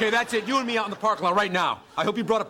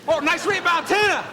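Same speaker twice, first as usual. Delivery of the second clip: shouting; 11 semitones higher, 5 dB louder, slower than usual. Acted. Cheering from the sideline.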